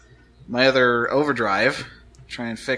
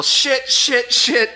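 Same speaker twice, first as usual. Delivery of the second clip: yelling; 9 semitones higher, 3 dB louder, much slower than usual. Acted. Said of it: "[name] is just gonna yell".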